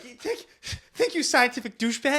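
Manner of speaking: high-pitched, flustered self-scolding; mock panic. Scientist character urging himself to think, mock frantic. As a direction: imitate a rattled actor berating himself. Imitating Wahlberg's panicked outburst in film.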